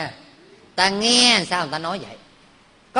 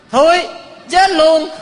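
higher, louder cry of the verdict; mock shout; 7 semitones higher, 5 dB louder, slower than usual. Official blurts the truth and is sentenced, loud mock shout. Courtier says stinks, is executed.

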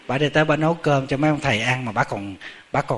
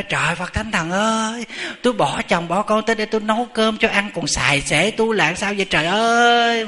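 high, wailing mock outburst; hammed-up aggrieved yelling, pitched way up; imitated shrieking; 8 semitones higher, 4 dB louder than usual. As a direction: cry out to the heavens in exaggerated outrage. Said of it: "bà hét lên mà quý vị biết".

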